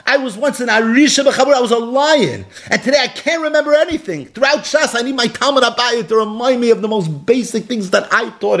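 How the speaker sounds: high, pleading sermon voice; impassioned preaching. Acted. Preacher retells a sage who forgot his learning, impassioned. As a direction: climb high and press each phrase home. Preaches the ailing rabbi's plea.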